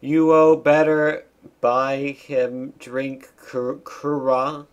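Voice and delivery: loud, slow cutscene threat; mock demand. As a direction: lean heavily on each word of the demand. Cutscene threat read aloud.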